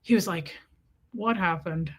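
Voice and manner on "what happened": lower, covert question; mock-discreet sideways delivery; side-of-mouth aside. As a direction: ask from the corner of your mouth. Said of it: "Why do people think that that's discreet?".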